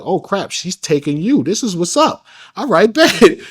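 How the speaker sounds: hyped, laughing at his luck; excited. Retold or imitated. Retelling his first thrill on the couch, excited and laughing.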